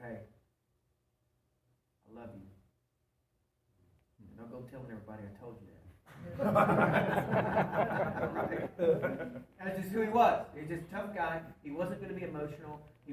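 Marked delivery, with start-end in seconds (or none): 0.0-5.7 softer, quick mumbled confession; a tough man half-hiding his love; gruff tender aside. Retold or imitated. Granddad tells the eight-year-old boy he loves him, softly. 5.8-9.5 loud open laugh; big laugh. Real, not acted. Preacher laughs at his granddad's line, loud.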